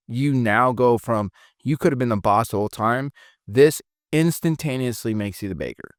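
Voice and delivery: usual speaking voice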